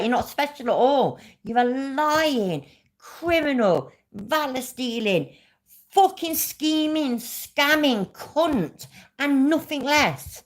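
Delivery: high, drawn-out angry insults; real, heated denunciation; furious tirade. Real, not acted. Direct angry insults at the rival.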